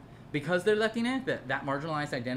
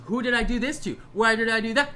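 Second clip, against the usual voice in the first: higher, louder whiny mock questions; raised sneering mimic of someone demanding answers; mocking his questions; 6 semitones higher, 6 dB louder than usual. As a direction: parody a confused man. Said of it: "He's asking questions".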